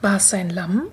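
usual speaking voice

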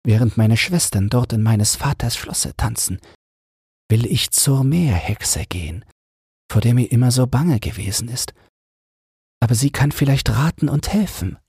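the little mermaid resolving to seek the witch, lightly voiced. Little mermaid speaks her plan.

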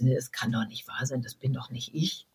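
quietly hearing your own voice for the first time; stunned disbelief. Retold or imitated. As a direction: murmur it as if you can't believe your ears. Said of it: "und sagt".

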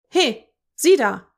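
shouting. A narrator's reading. Customer calls out loudly.

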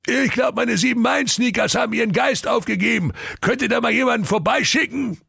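high ogre voice asking for help; helpless ogre. Acted. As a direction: plead into the call box. Ogre calls the emergency line.

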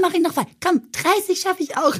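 high-pitched, mock-eager self-motivation; eager self-talk. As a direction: egg yourself on in a high voice.

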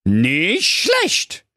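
high, cheeky boot-wearing dog voice; cheeky dog character. A narrator's reading. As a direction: goad the wizard with a high voice. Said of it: "rief da der Köter".

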